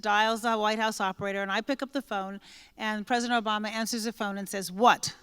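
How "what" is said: impatient boss cutting you off; sharp annoyed what. Retold or imitated. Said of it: "you usually don't use that tone of voice with me".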